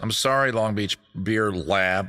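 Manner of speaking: usual speaking voice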